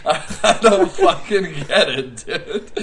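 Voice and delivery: high, loud, laughing through it; laughing bafflement. Real, not acted. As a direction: crack up in baffled disbelief. Baffled by gorilla mascot, cracking up.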